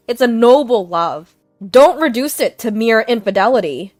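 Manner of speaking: loud, earnest insistence; husband defending his affair, loud; passionate declaration. Acted. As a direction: proclaim your love defiantly.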